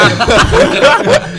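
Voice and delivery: a sudden real shriek of laughter; cracking up at the pizza joke; loud high laugh. Real, not acted.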